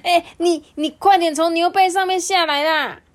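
high, loud urgent yell; urgent, panting warning. A narrator's reading. Friends to doctor on a cow, alarmed high shout. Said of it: "气喘吁吁地说".

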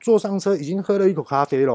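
ordinary talk, nothing marked

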